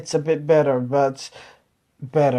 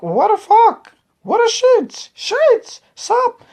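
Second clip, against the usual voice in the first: very high, louder, slangy imitation of locals; mock casual American; 4 dB louder, slower than usual. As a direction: toss out the curses breezily in a much higher voice. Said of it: "You're like".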